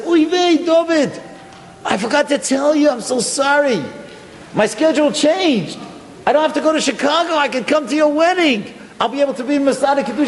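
high, eager apologetic rabbi voice; the Rebbe bursting with good news; fast excited old rabbi. Retold or imitated. Rebbe apologizes, changed schedule.

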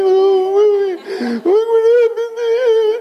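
high, loud hammed-up wail; drawn-out exaggerated sobbing imitation; mock crying. Girl watching a tearjerker movie, exaggerated tearful wailing. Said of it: "the girl sits there and she's crying".

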